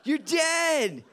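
high, slow taunting yell; mocking shout. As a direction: chant it loud like heckling friends. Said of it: "are screaming".

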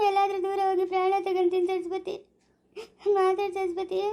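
crying, begging to be answered; tearful pleading. Acted. Husband begging silent Saraswati to speak, crying. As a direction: plead through sobs. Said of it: "ಅಳ್ಬೇಡ ಚಿಮ್ಮೇಡು".

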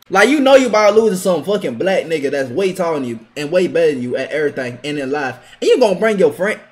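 normal talking voice

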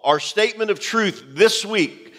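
ordinary talk

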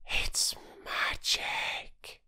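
slow, whispered awe; breathless. A narrator's reading. Little girl awed by walking trees, breathless whisper. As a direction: breathe it, barely voiced. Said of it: "said Phyllis breathlessly".

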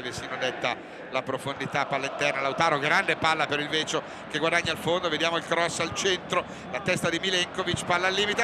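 ordinary delivery

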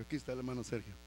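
normal talking voice

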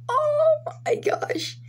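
very high breathless whisper, slow; a real whispered cry of amazement; awestruck whisper. Real, not acted. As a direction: gasp it out in sheer disbelief. Reacting to prized card.

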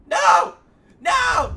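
high, loud cry of protest; anguished shout. Real, not acted. Gamer shouting as the dog dies, anguished.

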